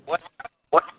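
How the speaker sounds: breaking up on the line; choppy phone line; bad connection. Real, not acted. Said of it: "you sound like you're breaking up a little".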